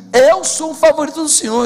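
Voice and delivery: high, loud declaration; proud cry. Acted. Believer declaring to God, loud and high. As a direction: claim it like a victory shout. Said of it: "voices a believer's claim".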